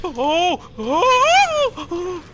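high panicked oh's breaking into a nervous laugh; frightened cry. Real, not acted. Gamer startled by creatures, panicked high cry. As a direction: yelp in real fright then laugh it off. Startled by monsters in horror game.

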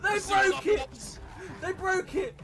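high, alarmed voice; panicked cry. Real, not acted. Warning Dan to get up the rope, panicked cry.

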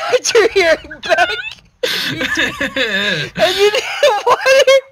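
words cracking high with laughter; real laugh-crying; laughing to tears. Real, not acted. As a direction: struggle to finish through tearful laughter. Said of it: "I'm fucking literally crying".

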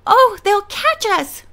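high, loud, drawn-out wail. A narrator's reading. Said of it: "Bess wailed".

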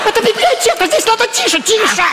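high indignant scolding; exasperated scolding. Acted. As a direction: scold him, voice rising high.

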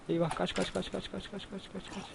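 panicked run. Real, not acted. Fleeing zombies in panic.

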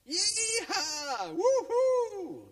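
very high, loud cheering yell; squealing cowboy cheer; triumphant whoop. Acted. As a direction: whoop it out in victory. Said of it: "yelled the friends".

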